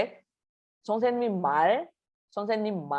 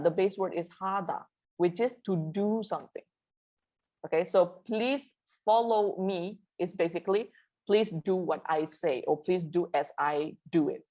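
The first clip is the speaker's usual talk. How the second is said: ordinary delivery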